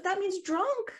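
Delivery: lightly voiced friend explaining; imitating friend. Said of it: "She goes".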